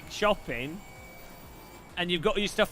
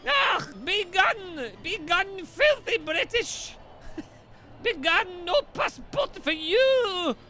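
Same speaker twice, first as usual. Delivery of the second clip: much higher, slow hammed-up Scottish accent, banishing; mock Scottish shout; 14 semitones higher, slower than usual. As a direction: roar it out like a cartoon Scot turning someone away. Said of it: "It's literally how they talk in Scotland".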